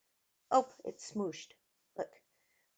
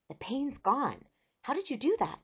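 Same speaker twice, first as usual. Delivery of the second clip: higher, wondering voice; amazed; 6 semitones higher than usual. A narrator's reading. Eric questions girl's magic healing, amazed higher voice. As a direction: marvel at a sudden cure.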